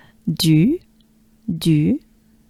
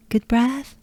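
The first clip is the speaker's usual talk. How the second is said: ordinary talk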